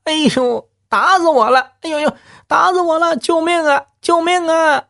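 very high hammed-up wailing; fake cry for help. Lout rolls on ground faking a beating, hammed-up high wail. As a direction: play dying theatrically, shrieking high. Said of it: "喊着".

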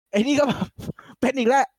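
high, laughing through the words; amused laughing retell. Real, not acted. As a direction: giggle as you tell it.

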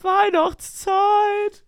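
high, very slow stretched call; drawn-out high shout; stretched jingle. Shown as a demonstration. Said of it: "noch mal von vorne".

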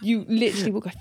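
ordinary talk

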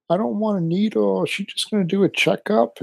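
high, soft imitation of a scared son; lightly voiced whining child; whiny kid. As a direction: mimic a scared little boy pleading. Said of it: "he was flipping out".

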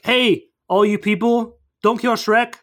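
half-hearted rallying call. Retold or imitated. Calling out to crowd, retold.

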